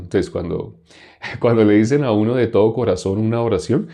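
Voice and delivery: ordinary delivery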